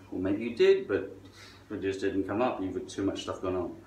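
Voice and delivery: normal talking voice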